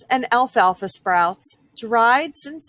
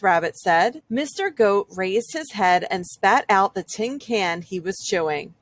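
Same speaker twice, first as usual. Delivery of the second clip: ordinary delivery, nothing marked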